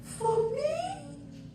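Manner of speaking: high breathy disbelief, soft; overwhelmed surprise at a gift; delighted surprise. Retold or imitated. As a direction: gasp it out as a touched, amazed woman. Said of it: "She was like".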